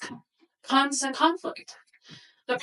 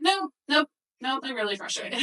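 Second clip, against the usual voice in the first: decisive, emphatic correction of oneself; firm self-correction; 3 semitones higher than usual. Retold or imitated. Mom correcting herself to her boss, firmly admitting frustration. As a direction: take back the hedge and own it.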